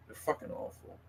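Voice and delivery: hushed, breathy whisper of disgust. Real, not acted. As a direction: whisper it under your breath. Disgust at cruelty to animals.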